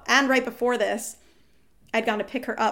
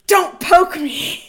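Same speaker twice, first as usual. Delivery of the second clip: loud, higher, slow mock snap; snapping partner; 8 semitones higher, 11 dB louder, much slower than usual. Partner woken at ten, loud mock snap. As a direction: bark it like she was rudely woken. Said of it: "Her response was".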